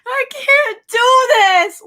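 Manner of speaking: high, loud sobbing cry; crying shout. Real, not acted. Fan overwhelmed by the goodbye post, crying shout. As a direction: wail it through tears.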